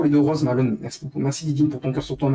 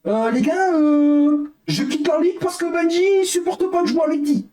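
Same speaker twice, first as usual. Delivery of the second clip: high, whiny mimicry of the other player; mocking quote; 11 semitones higher than usual. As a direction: parody his complaint in a high put-on voice. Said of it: "à dire".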